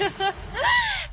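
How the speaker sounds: shrill, high-pitched giggle; squeaky little laugh. Acted. Weather girl laughs.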